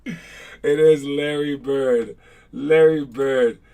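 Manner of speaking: drawn-out reveal of Larry Bird; slow emphatic answer reveal. Acted.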